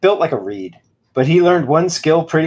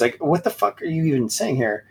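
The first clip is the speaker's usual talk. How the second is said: ordinary delivery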